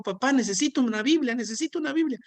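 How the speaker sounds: lightly voiced daughter begging papá for a Bible; eager daughter quote.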